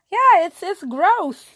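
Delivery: a loud high TV-character "it's gross"; Insecure character imitation. Brushing off praise like the show's madam, a loud high squeal. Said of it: "as that madam for Insecure would do".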